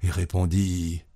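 usual speaking voice

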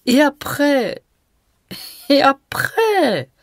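high, teasing and slow on the second; provocative and droll. A narrator's reading. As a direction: toss it out provokingly, drawn out. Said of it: "d'un petit air provoquant et drôle". (ton provocant et drôle, aigu)

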